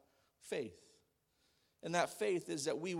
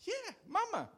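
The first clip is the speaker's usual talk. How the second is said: put-on high little girl's answer Mama; dad mimicking his daughter's sing-song reply; toddler voice. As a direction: chirp it like a small child.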